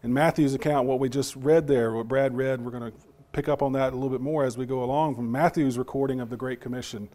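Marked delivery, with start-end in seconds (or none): none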